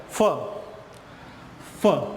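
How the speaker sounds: "फ" as a short, isolated f sound; letter-sound demo. Shown as a demonstration. Teacher showing children how F is pronounced, letter-sound demo. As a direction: say "फ" alone, then repeat it after a pause. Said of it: "एफ का सिर्फ एक ही pronunciation होता".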